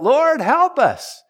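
high, loud, slow desperate plea; crying out to God. Retold or imitated. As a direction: call out for rescue, stretching each word. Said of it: "they cry out".

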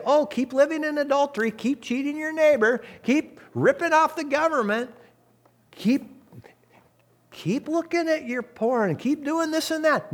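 high, mocking sing-song of excuses; mock permissive voice. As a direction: parody a church that shrugs at sin. Mocking an imagined permissive church.

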